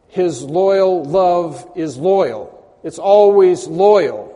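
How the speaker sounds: slow, heavy stress on loyal and always; emphatic. Shown as a demonstration. Preacher stressing God's loyal love to the congregation, slow and emphatic. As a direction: lean hard on always, word by word. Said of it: "you're being emphatic".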